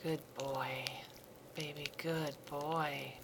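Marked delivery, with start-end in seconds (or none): none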